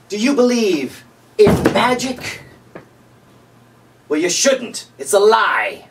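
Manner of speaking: lightly hammed-up pitchman asking a slow teasing question; a hint of a TV salesman, slow on the question; infomercial pitchman. Late night infomercial riff.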